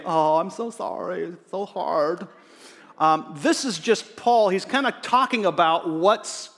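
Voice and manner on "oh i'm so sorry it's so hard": slow, drawn-out pity of a friend; mock sympathy. Preacher imitating sympathetic Facebook friends, mock sympathy. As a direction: put on exaggerated sympathy, stretching it slowly. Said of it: "sympathetic friends to say".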